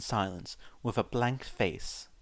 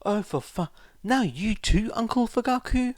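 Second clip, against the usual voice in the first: put-on exasperated boy; 12 semitones higher, 6 dB louder than usual.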